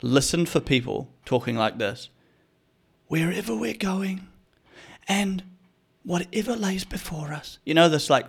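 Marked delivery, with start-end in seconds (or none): 3.1-4.3 high, soft, breathy ad voiceover; raspy mummy voice. Retold or imitated. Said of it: "raspy mummy voice". 5.1-7.6 high, soft, breathy ad voiceover; raspy mummy voice. Retold or imitated. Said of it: "raspy mummy voice".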